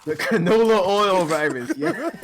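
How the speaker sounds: slow, laughing repeat. Real, not acted. Echoing his co-host's joke, laughing slowly. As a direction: chuckle through the words.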